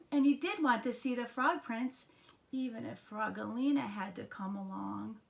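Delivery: usual speaking voice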